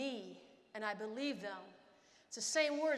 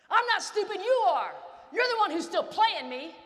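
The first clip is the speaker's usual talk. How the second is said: higher, louder retort at the coach; angry talk-back; 6 semitones higher, 10 dB louder than usual. Retold or imitated. A benched player yelling back at the coach. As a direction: fire it back at him, loud and high. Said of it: "what a stupid person would do".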